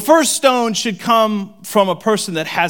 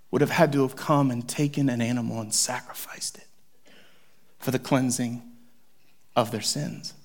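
hushed; 5 semitones lower, 6 dB quieter than usual. Real, not acted. Solemn hushed description.

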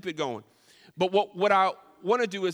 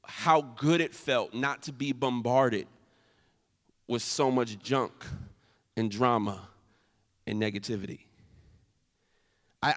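sincere confession; 6 semitones lower than usual. Real, not acted. Confessional reflection.

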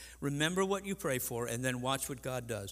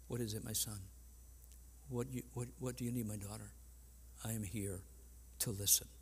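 soft, low, tender reassurance; gentle father voice; 3 semitones lower, 7 dB quieter, faster than usual. Pretend. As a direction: answer your child gently. God answering his child.

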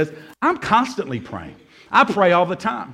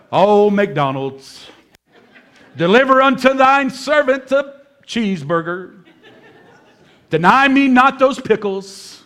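hammed-up archaic prayer, drawn out and laughing; mock King James prayer. Pastor prays to the McDonald's screen, hammed-up King James solemnity. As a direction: pray for a cheeseburger like a solemn old preacher. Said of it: "imagine praying like that".

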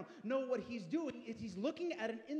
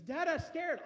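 put-on higher, louder little boy's plea; scared child; 7 semitones higher, 6 dB louder, slower than usual. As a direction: mimic a frightened son running to dad. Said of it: "comes running up to me and says".

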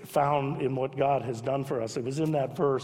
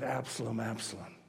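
softer, lower, each name fading; grieving repetition; 5 semitones lower, 9 dB quieter than usual. Retold or imitated. David mourning his son, softly repeating the name. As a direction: let the name fall away in grief. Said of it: "over to emphasize how much passion".

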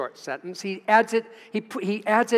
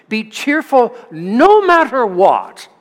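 emphatic; 5 semitones higher, 10 dB louder, slower than usual. Acted. Reading The Message aloud.

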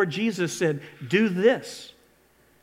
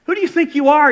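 louder and higher, a mocking rebuke; scornful challenge; 8 semitones higher, 7 dB louder, faster than usual. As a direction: scoff at the boy loudly. Saul scolding David.

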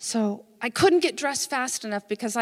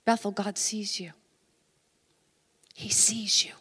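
tender assurance; 4 semitones lower than usual. Real, not acted. Preacher turns tenderly to congregation.

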